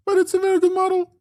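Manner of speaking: sudden squeaky falsetto; high voice. Shown as a demonstration. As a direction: flip up into a tiny high voice. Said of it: "in the high and the low voice together".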